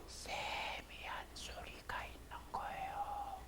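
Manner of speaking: slow breathy whisper of a still small voice; hushed, slow whisper; soft whisper. Shown as a demonstration. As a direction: whisper it like a faint voice from God.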